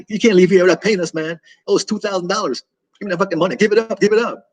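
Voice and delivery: high-pitched mocking voice, hammed-up and pushy; mock greedy hospital staff. Mocking US hospital staff demanding money before a patient leaves, hammed-up high voice. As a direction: play the greedy US billing clerk squeezing a patient. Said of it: "They're shaking that money out of you".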